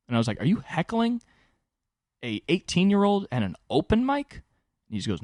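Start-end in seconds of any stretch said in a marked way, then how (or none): 0.4-4.4 high-pitched incredulous retort, retold; mock incredulity. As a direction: challenge the heckler in a high, disbelieving voice. Retold comeback to a heckler.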